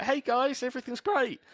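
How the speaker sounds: very high-pitched chirpy enthusiasm; hammed-up cheer to lift the room; upbeat. Someone spreading good mood to friends, brightly upbeat. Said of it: "if someone else is being like".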